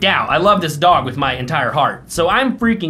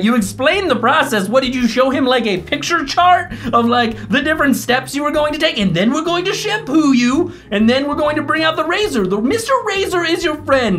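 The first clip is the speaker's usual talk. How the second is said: high, sing-song mocking of the groomer; mocking the groomer's explanation, sarcastic and sing-song; sarcastic mockery; 8 semitones higher than usual. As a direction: talk down to her like a kindergarten teacher.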